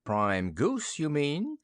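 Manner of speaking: snickering witch. A narrator's reading. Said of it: "snickered Mombi".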